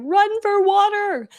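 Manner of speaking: high, slow yell of a panicked mom; imitating mom's panicked yell. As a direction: mom shouting orders in alarm. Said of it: "She's like".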